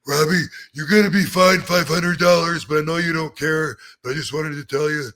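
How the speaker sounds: lightly put-on boss voice telling off a wrestler; half-hearted impression of the booker; Johnny Ace impression. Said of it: "if I'm gonna do an impression".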